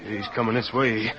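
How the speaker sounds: normal talking voice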